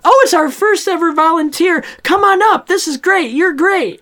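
very high, loud, gushing hostess voice; thrilled Elizabeth Banks. Retold or imitated. Effie welcoming the first volunteer on stage, squealing with delight. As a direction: gush like an over-the-top Capitol host. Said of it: "Elizabeth Banks is just thrilled".

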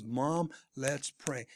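slow, measured appeal to mom; calm, deliberate urging. Retold or imitated. Son urging his crying mother to pray, calm and deliberate.

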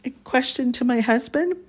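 usual speaking voice

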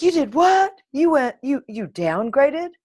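high, loud, mock disbelief; hammed-up shock, pitch shooting up; imitating shocked reaction. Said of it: "People would be like".

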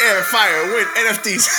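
high, loud laughing list. Acted. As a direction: crack up while listing.

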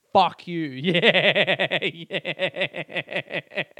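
defiant shout dissolving into laughter; cheeky defiant laugh; laughing defiance. Real, not acted. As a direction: toss it out, then crack up. Declaring podcast title, laughing.

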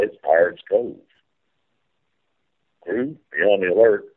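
folksy tour-guide captain calling the sights; riverboat captain spiel. Pretend. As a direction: play the steamboat captain giving the tour.

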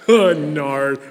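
laughing out loud, high and loud; real laughter. Real, not acted. Preacher cracking up reading Song of Solomon aloud, laughing. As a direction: burst out laughing at the text. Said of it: "I'm laughing".

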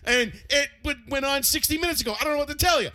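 high, loud exasperated retort; exasperated kid. Retold or imitated. Son snapping back at dad about the dryer. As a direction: fire back at dad, fed up. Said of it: "I'm like".

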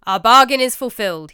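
loud, grand knightly voice; Green Knight. A narrator's reading. Green Knight declares the bargain fulfilled in a loud grand voice. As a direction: declare the deal done.

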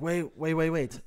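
put-on African accent. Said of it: "why I'm doing this accent".

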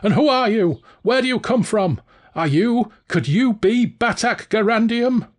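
high, bewildered villain voice; baffled Valjean. A narrator's reading. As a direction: sound lost and thrown, pitched high. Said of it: "Valjean said, baffled".

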